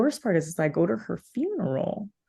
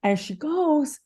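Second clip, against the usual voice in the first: normal talking voice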